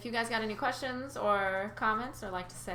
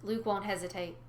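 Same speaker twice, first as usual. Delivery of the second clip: ordinary delivery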